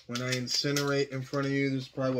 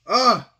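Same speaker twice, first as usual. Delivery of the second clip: pained gasp; 8 semitones higher, 9 dB louder than usual. Real, not acted. Reacting to burning spice.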